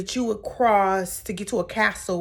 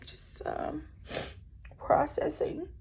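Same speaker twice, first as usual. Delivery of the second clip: high, whispered, slow hesitant mumble; imitating a timid whisper; 9 semitones higher, 8 dB quieter, slower than usual. Imitating Brittany answering Mary softly, whispered. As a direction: shrink into a mock whisper. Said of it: "And Brittany was like".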